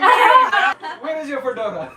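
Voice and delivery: put-on high, slow Indian accent with laughter. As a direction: tease him in an Indian accent. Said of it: "saying fedora with an Indian accent".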